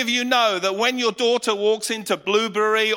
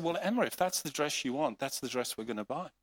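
gentle host; 6 semitones lower, 9 dB quieter, faster than usual. Retold or imitated. Kind host speaks to daughter.

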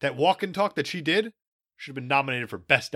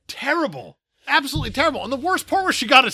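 much higher, hammed-up disgust; exaggerated outrage; 11 semitones higher, 6 dB louder than usual. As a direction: ham it up like it personally offended you. Mock outrage over bad acting.

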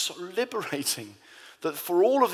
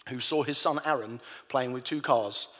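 ordinary talk

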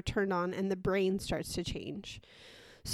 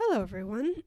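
usual speaking voice

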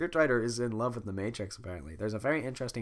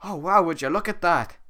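Shrek voice; 6 semitones higher, 8 dB louder than usual. Acted. Shrek looking at the arrow.

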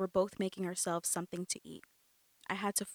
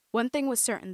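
ordinary delivery, nothing marked

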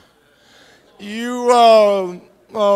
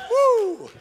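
excited woo; 13 semitones higher than usual. Real, not acted. Preacher's ecstatic exclamation.

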